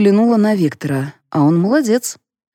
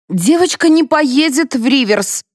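higher-pitched, firm refusal; curt refusal; 8 semitones higher than usual. A narrator's reading. Mother refusing to let her daughter go, curt and high. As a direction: shut it down in a raised pitch. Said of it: "отрезала Флора".

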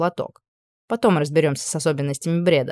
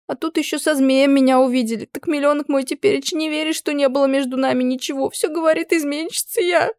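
high, tearful complaint; a teen girl, sniffling and sulky; 8 semitones higher than usual. Acted. Upset teen girl complaining to the witch, high and tearful. Girl's voice.

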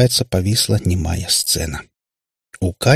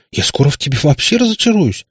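higher, indignant outburst; возмущённо; 7 semitones higher than usual. A narrator's reading. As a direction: reproach dad with youthful indignation. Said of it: "возмущённо воскликнула Катя". (с возмущением, повыше)

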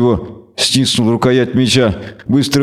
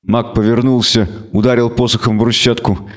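plain reading voice

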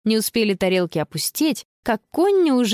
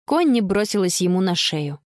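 ordinary delivery

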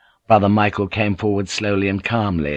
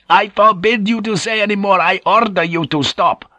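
high-pitched, forceful command; furious order; 10 semitones higher, 4 dB louder than usual. A narrator's reading. Superior forbidding a rebellious brother to speak, furiously. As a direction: cut him off with outraged authority.

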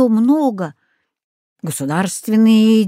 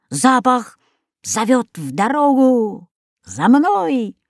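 dog Rex's call; 3 semitones higher, slower than usual. Acted. Dog Rex leads them on.

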